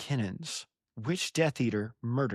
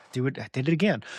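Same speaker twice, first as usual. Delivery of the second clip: ordinary delivery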